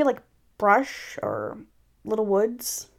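ordinary delivery

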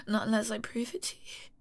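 soft, hushed vow; submissive whisper. Acted. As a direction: breathe it out quietly.

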